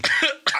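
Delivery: sudden coughing fit; choking cough. Real, not acted. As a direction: choke on a sip of water. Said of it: "Sorry, I'm choking him out".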